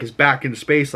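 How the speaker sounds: usual speaking voice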